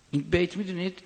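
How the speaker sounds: ordinary talk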